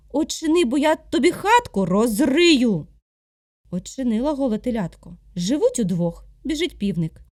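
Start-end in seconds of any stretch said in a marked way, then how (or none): 0.1-2.9 loud, slow boar threat; angry threat. Acted. Boar threatening to dig up the hut, angry and loud. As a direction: menace the calf, slowly and louder.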